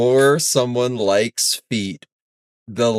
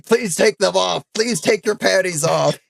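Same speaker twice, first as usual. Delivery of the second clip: mock screaming plea; 8 semitones higher than usual. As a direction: plead frantically, acting out someone pinned down. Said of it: "while he's screaming".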